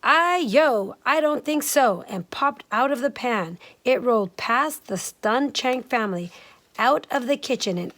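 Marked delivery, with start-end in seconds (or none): 0.0-2.0 higher, louder cheeky rice cake voice; higher cheeky cry of a living rice cake. Acted. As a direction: sing out like a mischievous runaway cake. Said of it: "It cried".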